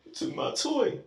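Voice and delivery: high breathy whimper; a high, voiceless, whimpering mock complaint; mock whiny kid. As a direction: whine it weakly like a pushover kid. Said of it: "if my son".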